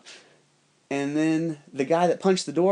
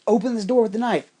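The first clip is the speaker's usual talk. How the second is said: higher, louder put-on voice; retold urgent command, pitched up; Wendell's instruction; 8 semitones higher, 5 dB louder, faster than usual. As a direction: give the order as an imitated helper.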